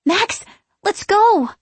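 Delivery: high, drawn-out urgent plea; urgent call. A narrator's reading. Danny pulling at Max's jacket, urgently calling. Sister urging her brother to flee.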